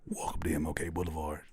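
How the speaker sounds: low, soft narrator voice with a laugh; narrator impression. Still mocking the producer's intro, low and laughing. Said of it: "you can't narrate your way out".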